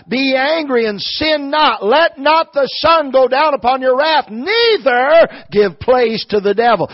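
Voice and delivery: loud, high, weighty verse reading; preached scripture. Acted. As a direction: proclaim the verse, stretching key words. Declaims a Bible verse.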